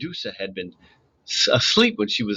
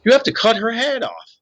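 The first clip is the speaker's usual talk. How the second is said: high urgent command; 11 semitones higher, 4 dB louder than usual. A narrator's reading. Urgent instruction.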